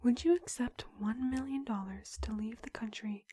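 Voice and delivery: usual speaking voice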